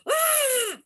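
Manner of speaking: high piercing ghost scream; sudden spooky shriek; ghostly shriek. Retold or imitated.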